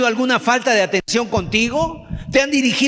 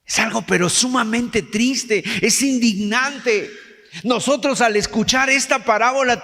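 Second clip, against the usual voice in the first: usual speaking voice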